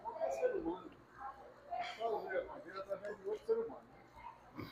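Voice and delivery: faint voice far from the mic; quiet distant speaker. Real, not acted. Church member adding softly to the pastor. Second speaker barely audible.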